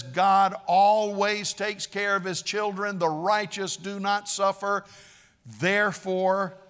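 loud, emphatic acted pronouncement; insistent lecturing. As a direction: insist on it like a self-righteous accuser. Preacher voices Bildad lecturing Job.

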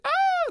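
very high, loud mock shriek; hammed-up squeal of horror; mock scream. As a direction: imitate a shocked crowd screaming. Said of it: "everybody's like".

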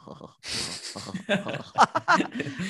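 long, exaggerated high caricature laugh; Roy Keane. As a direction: blow a little chuckle up into a cartoon laugh. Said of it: "I turned it into a".

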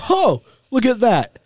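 high, drawn-out delighted realization; pleasantly surprised. Acted. Full diner spots room for pie at eight o'clock, pleasantly surprised.

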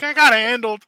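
high, loud imitation of a distraught friend; hammed-up wounded whine; mock tearful complaint. Mocks friend's distressed confession.

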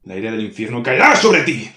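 loud ominous demon threat; booming threat. Acted. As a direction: promise her hell's fury. Demon threatens hell's wrath.

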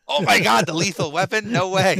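high, loud, thrilled disbelief; excited disbelief. Retold or imitated. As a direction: shriek it like a starstruck fan. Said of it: "and I'm like".

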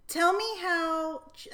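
usual speaking voice

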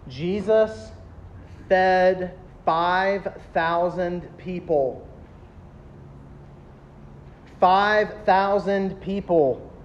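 word by word, with long pauses; slow it right down so each word sinks in; deliberately slow. Shown as a demonstration. Preacher to students, letting it sink in slowly. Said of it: "I'm gonna say this slowly".

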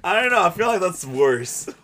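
high, loud laughing reply. Real, not acted. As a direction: laugh while answering.

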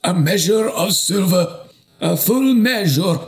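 desperate bishop offering a full measure. Acted. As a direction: beg to have it at any price.

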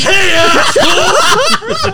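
high, drawn-out mock yell; hammed-up angry shout. Repeating pissed-off dad's insult on request, hammed-up shout. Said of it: "Say "asshole" again".

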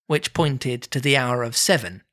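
ordinary delivery, nothing marked

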